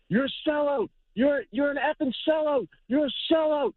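high-pitched mock yell, no real volume; high, contained imitation of someone yelling insults; retold screaming. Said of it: "starts screaming".

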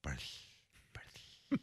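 Barth whispered with a th ending; lisped Barth. Shown as a demonstration. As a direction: breathe Barth out softly with th. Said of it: "It's almost like a lisp".

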